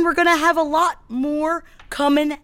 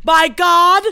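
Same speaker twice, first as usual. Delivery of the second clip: mock preaching; 6 semitones higher than usual. Mock empowerment sermon.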